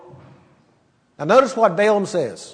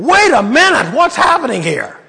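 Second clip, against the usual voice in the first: startled double take; 5 semitones higher than usual. Pretend. Balaam to his talking donkey, startled and confused. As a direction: stop short in disbelief. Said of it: "he should have said".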